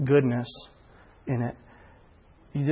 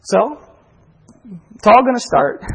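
higher and louder, a hint of playful triumph; mock announcement; 7 semitones higher, 7 dB louder than usual. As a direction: proclaim the threat with playful glee. Echoing lawyer's warning joyfully.

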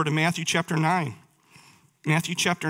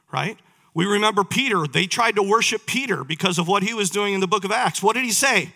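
ordinary talk

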